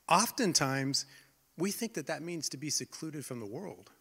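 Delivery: ordinary delivery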